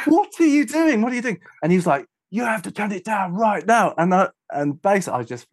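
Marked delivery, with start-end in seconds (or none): 0.1-1.4 high, agitated disbelief; incredulous protest. Retold or imitated. Engineer to the man grabbing him, high-pitched retold disbelief. 2.3-3.9 high, insistent mock order; annoyed demand. Annoyed man orders the engineer around, high insistent imitation. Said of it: "he was really, really annoyed".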